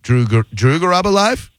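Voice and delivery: "Drew Garabo live" climbing steadily into a question; questioning tune. Shown as a demonstration. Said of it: "I'm gonna say it another".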